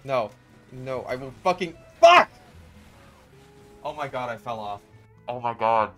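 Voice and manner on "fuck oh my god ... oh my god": normal talking voice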